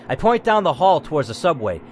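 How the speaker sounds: usual speaking voice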